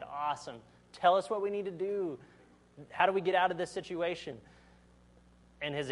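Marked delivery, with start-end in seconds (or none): 0.0-4.4 eager, rising and higher; mock excited sailors. Sailors begging Jonah for a plan, lightly eager. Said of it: "the sailors are excited".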